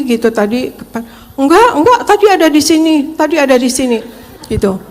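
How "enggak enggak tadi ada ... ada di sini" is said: higher and louder mock insistence; imitating a husband insisting hard; husband snapping at wife over the missing pen, louder; retold snapping. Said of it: "Udah bentak-bentak saya".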